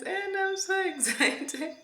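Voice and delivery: can't hold back the giggles; giddy laugh mid-word; giggly excitement. Real, not acted.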